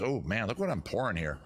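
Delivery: ordinary delivery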